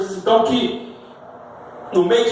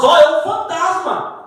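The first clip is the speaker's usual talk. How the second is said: high, raised cry of sighting a ghost; lightly acted fright, pitched up; mock alarm; 10 semitones higher, 3 dB louder than usual. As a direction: act out a startled call.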